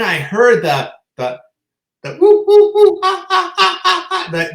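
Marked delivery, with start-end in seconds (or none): 2.0-4.5 loud, high whooping bird cackle; whoop-whoop then rapid ah-ah-ah, much higher and louder; kookaburra call imitation. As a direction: mimic a kookaburra in full cry. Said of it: "the kookaburra".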